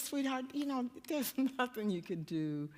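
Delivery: hushed, low husband voice; very soft. Retold or imitated. Husband tells his mortified wife he still loves her, very soft. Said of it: "he's very soft".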